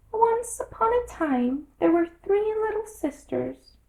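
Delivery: very high, squeaky little Dormouse voice; hurried Dormouse. A narrator's reading. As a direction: rush into the tale like a tiny creature. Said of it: "the Dormouse began in a great hurry".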